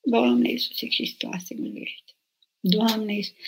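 quiet, slow repeated prayer, almost breathed; soft slow prayer. Shown as a demonstration. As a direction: pray it softly to yourself, drawing each word out. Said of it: "cântă mă la chitară". (rugăciune rostită încet și rar)

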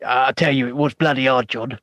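low put-on voice; Vaughan. Co-host insists to John it was hard, as low-voiced Vaughan. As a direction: keep it deep and matter-of-fact. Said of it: "putting on that bloody voice like that".

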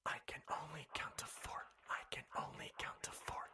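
eerie unvoiced whisper. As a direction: breathe it out like a ghost. Repeated whispered line over music.

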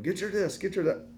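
mock bark of orders at the kids. A parent barking orders at a dawdling boy, mock bark. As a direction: snap the chores at him like a drill. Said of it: "having to start barking at him".

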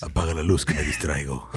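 very low, soft mock growl; deep mock monster voice. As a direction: growl it like the moth creature. Mocking Mothman voice.

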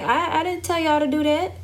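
high, amused mock rebuke from God; God scolding. God telling the Israelites off, high mock rebuke. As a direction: raise the pitch and scold lightly, as God. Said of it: "God said".

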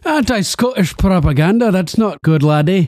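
hammed-up Scottish shopkeeper; mock Scottish rant. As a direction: rail at it like a proud Scotsman. Scottish character, says laddie.

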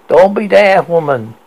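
grizzled cry. Acted. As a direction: snap at her like an old sea dog. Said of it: "Ahab cried".

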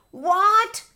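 high, loud cry of outrage; acted shocked exclamation. A narrator's reading. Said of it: "cried the fox".

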